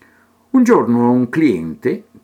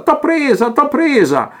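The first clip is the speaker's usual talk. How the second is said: higher mock cry of triumph in an altered voice; triumphant cry; 13 semitones higher than usual. Armando the painter crowing over Teresa, high and triumphant. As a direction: crow it out like a painter grabbing his prize. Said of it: "gridava distesa".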